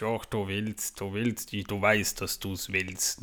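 low, slow, coaxing wizard voice; Gandalf's voice. Retold or imitated. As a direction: talk him into it, deep and slow. Gandalf's reply to Bilbo. (tiefe, langsame, überredende Zaubererstimme)

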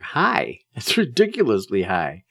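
scoffing at the reading; incredulous echo. Real, not acted. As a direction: throw the word back in disbelief. Disbelief at blood pressure reading.

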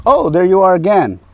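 high, loud mock-friendly greeting; chirpy canvasser greeting. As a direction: imitate her singsong surprise on spotting someone. Canvasser's greeting acted out in retelling.

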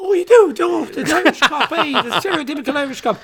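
a playful mock Irish brogue; mock Irish accent. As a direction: poke fun at him in a put-on Irish accent. Mocking friend's liking for Irish cop.

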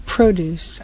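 produce stressed on PRO, long O; produce, first syllable stressed; noun produce. Shown as a demonstration. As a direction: say produce as PROH-dooce, the noun. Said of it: "Hear the difference?".

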